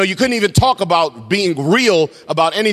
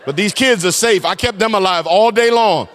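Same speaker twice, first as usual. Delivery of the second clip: hammed-up exasperated mom, loud and higher; mock threatening mom; 3 semitones higher, 5 dB louder than usual. Fed-up mom answers the husband who asks about her day, mock threatening. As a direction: play a mom at the end of her rope.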